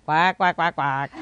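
imitated duck quacking; parrot's duck impression; duck quacks. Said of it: "if she say like a duck".

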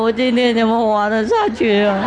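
theatrical grief over sweets; mock despair. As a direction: wail about the lost candy. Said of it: "那个师父好懊恼".

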